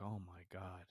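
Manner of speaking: soft, daunted "oh my God"; retold hushed dread. Climber looks all the way up, hushed dread. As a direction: say it under the voice, overwhelmed by the height.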